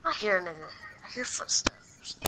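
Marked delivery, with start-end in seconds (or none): 1.0-2.2 slow tense whisper. Acted. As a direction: breathe it, listening. Hiding villain hears someone.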